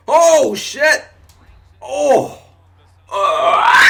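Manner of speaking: loud, high hammed-up hiccup yelps; exaggerated hiccups. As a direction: blow each hiccup up into a yell. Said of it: "exaggerate it like fucking crazy".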